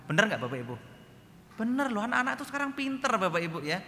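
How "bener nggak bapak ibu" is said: ordinary talk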